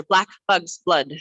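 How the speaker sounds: tongue twister with words closer together. Shown as a demonstration. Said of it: "A little faster".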